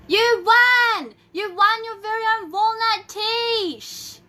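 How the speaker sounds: high, loud joyful shouting; excited yelling. Real, not acted. Host yelling congratulations to the prize winner. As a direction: yell the news with glee. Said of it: "Why am I yelling?".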